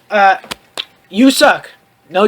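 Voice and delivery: normal talking voice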